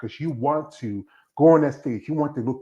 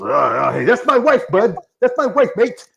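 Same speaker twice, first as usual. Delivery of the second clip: protective husband; 9 semitones higher, 7 dB louder than usual. Retold or imitated. Husband warning off another guy.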